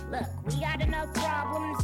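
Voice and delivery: ordinary talk, nothing marked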